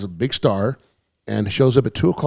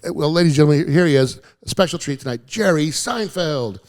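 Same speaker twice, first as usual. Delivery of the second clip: imitated emcee build-up; club MC; 5 semitones higher than usual. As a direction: introduce the star with a big stretched-out name. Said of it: "The M C goes".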